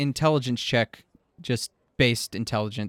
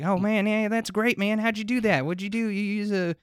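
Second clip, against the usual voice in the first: excited patron; 9 semitones higher than usual. Acted. Excited patron voiced in character.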